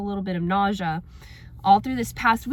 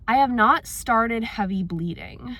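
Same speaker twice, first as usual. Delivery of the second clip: normal talking voice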